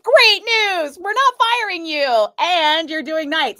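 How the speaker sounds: high, loud sing-song parody of fake cheer; mocking the bosses. Mocking the bosses' cheerful demotion pitch, high and loud. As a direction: mimic their fake good news with scorn.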